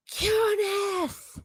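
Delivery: high, drawn-out classroom command; mock yell. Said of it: "what would be yelled the most".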